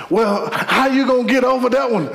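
high, insistent question; pressing questioner. Acted. Someone pressuring you about getting over it, high and insistent. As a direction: press them, pitched well up.

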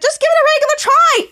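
very high mock yell; acted group exasperation. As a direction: yell it for the whole fed-up group. All characters repeat it together.

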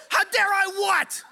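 high, loud, drawn-out disbelief; hammed-up outraged retort; incredulous mock shout. Storyteller fires back in acted quarrel.

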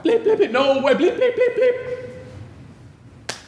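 very high mock bleep tone; censor bleep. Pastor imitates Peter's cursing being bleeped out, high bleep noise. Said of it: "It had to get bleeped out".

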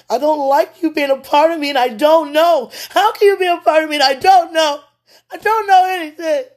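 high, loud sobbing cry; breaking down in tears, pleading. Real, not acted. Alone on a live video, crying out to God in anguish. Emotional outburst while talking to God.

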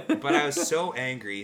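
ordinary talk

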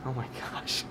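soft whispered oh my gosh; whispered disbelief. Real, not acted. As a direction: breathe it out in disbelief. Host reacts to wrong answer.

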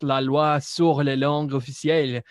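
Quebec French pronunciation, lightly done; Quebec French accent. Acted. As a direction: say it like a Québécois. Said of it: "to speak in a Quebec accent".

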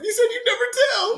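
high, loud hurt protest; mock upset whine. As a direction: cry it out as a wounded accuser. Mimicking the upset Half Sack.

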